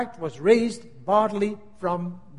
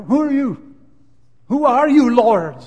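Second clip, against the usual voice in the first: loud, climbing, desperate question; awed cry; 4 semitones higher, 10 dB louder than usual. Shown as a demonstration. Preacher reliving Saul's cry, loud and desperate. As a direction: pour everything into the word Lord. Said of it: "just that sentence touched my heart".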